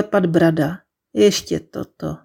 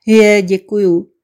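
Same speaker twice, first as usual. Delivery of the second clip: slow, louder, tearful thanks; thanks through tears; 5 dB louder than usual. A narrator's reading. Daniela thanking Mia through tears with a sad smile. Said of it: "skrze slzy úsměv smutného klauna".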